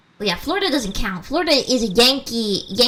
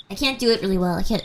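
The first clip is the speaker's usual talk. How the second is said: usual speaking voice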